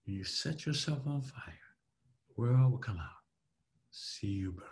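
soft, slow, sinking to a whisper; hushed, emotional, trailing into breath; low and slow. Shown as a demonstration. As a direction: let it out gently with long pauses. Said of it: "low and slow is for emotion".

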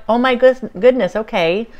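high, loud taken-aback reaction; retold surprise. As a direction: react startled, voice jumping up. Retold startled reply to the voice.

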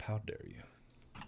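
breathy hushed mock reproach; mock whisper. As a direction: drop to a fake-offended whisper. Mock scolding after outburst.

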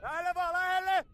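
loud call, building on the repeat; shouted instruction. Real, not acted. Coach sends players in close, insistent shout.

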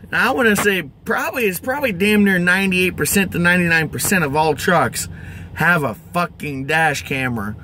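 ordinary talk

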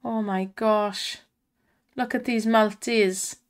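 normal talking voice

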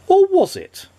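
very high, teasing question; teasing suspense. A narrator's reading. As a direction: tease the listener. Narrator teases suspense.

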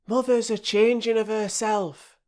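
put-on high, cheeky little boy; small boy announcing from the doorway; partly washed boy. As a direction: pop your head round and announce it.

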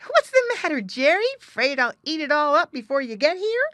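mock his friend's worry playfully; laughing tease. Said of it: "Little Joe laughed".

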